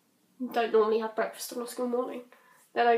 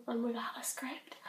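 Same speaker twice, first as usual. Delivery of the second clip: whisper; 9 dB quieter than usual. Real, not acted. Aside to viewers.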